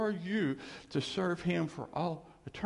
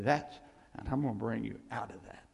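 usual speaking voice

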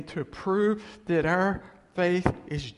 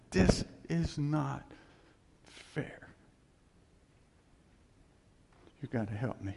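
quiet plea; 3 dB quieter than usual. Retold or imitated. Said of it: "I'll never forget that prayer".

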